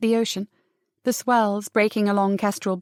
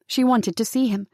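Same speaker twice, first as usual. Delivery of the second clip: ordinary delivery, nothing marked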